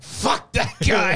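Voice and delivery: high, drawn-out mock outburst; pissed-off actor impression. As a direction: imitate an old film star's angry outburst. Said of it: "He gets pissed off".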